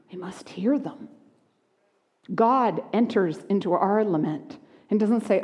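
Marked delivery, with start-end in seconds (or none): 0.1-1.1 hushed, breathy urgency; whisper. Acted. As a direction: whisper it to the congregation. Hushed preaching emphasis.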